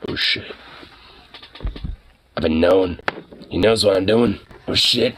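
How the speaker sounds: low, furtive voiced dog; caught-out dog's voice. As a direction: lightly play a dog who's been found out. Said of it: "He's just like".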